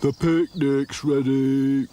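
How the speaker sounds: slow, loud call. A narrator's reading. Sad Sack calling friends to eat, slow and loud. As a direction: call everyone over. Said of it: "called Sad Sack".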